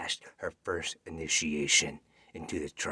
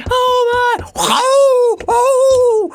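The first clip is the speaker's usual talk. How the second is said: panicked cries; 18 dB louder, much slower than usual. Acted. Girl running from wolves.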